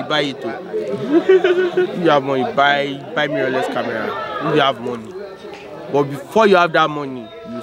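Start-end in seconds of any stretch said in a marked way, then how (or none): 0.7-2.4 stretched out and higher; drawn-out pitch to buyers; slow emphatic. Shown as a demonstration. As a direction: lean on IF you have money, slowly.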